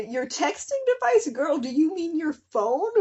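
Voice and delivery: high incredulous mock reply, stretched out; mocking disbelief. Real, not acted. Mocking the fic's wording to viewers, incredulous. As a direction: scoff at the silly phrase.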